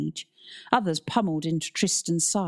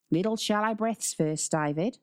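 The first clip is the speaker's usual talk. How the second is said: Aussie coach giving advice; steady an injured pupil in an Australian accent; lightly voiced Australian teacher; 4 semitones higher than usual. A narrator's reading. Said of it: "It was Australian".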